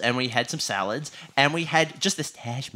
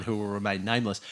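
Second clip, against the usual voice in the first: ordinary talk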